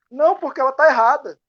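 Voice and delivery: indignant retort. Retold or imitated. Man defending himself to the podcaster, loud indignant retort. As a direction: snap back that she's wrong, loudly. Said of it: "man's reply in retold dialogue".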